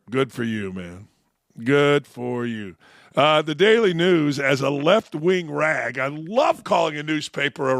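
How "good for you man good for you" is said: lower, slow dismissive good for you; sarcastic. Real, not acted. Host brushing off the Yankees statement, low and slow sarcasm. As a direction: drip with sarcasm.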